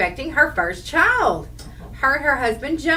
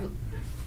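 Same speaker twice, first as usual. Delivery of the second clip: sudden cough; 14 dB quieter than usual. Real, not acted. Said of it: "probably swallowed a bug".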